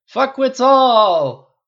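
drawn-out, high, loud curse; drunken cry. A narrator's reading. Tony damning the tabloids over drinks, drawn-out cry. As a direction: bellow it as a toast. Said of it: "Tony cried".

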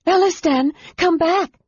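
high frightened call; fearful cry. Acted. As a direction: cry out in fear. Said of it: "Laurana cried fearfully".

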